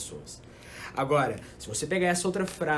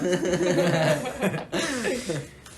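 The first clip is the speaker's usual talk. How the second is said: laughing; 4 semitones higher, 5 dB louder than usual. Real, not acted. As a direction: burst of laughter. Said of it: "laughs at own voice".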